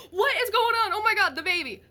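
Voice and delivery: put-on jolted awake by the baby, frantic; alarmed. Parents rushing to the screaming baby, alarmed and loud.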